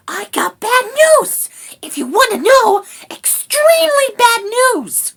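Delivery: cross little rat with grave news; grumbling rat. A narrator's reading. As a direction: complain loudly in a tiny rat voice. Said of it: "grumbled Rat".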